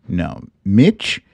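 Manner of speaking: ordinary talk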